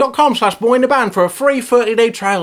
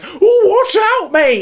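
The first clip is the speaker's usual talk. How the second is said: high, slow warning call; cartoonish alert voice; 13 semitones higher, 4 dB louder, slower than usual. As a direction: squeak out a friendly warning. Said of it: "Dashlane will be all like".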